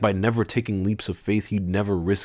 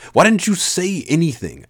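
high, louder exasperated question; annoyed; 11 semitones higher, 5 dB louder than usual. A narrator's reading. Narrator confronting Sajin for staying silent, annoyed. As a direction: demand it, irritated.

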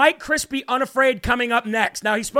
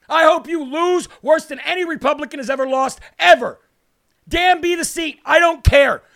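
loud angry declarations; heated; 3 semitones higher, 4 dB louder than usual. Real, not acted. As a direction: hammer it home, fuming. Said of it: "I just got heated".

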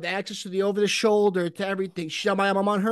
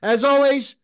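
announcer; 7 semitones higher, 6 dB louder than usual. Acted. Intro roll call.

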